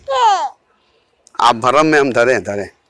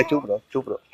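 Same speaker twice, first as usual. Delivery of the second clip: hushing; 2 semitones lower, 12 dB quieter than usual. Real, not acted. Hushing a crying child.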